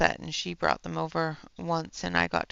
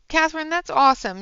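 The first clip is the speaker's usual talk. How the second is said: delighted; 10 semitones higher, 8 dB louder, faster than usual. Real, not acted. Thanked by a viewer's gift.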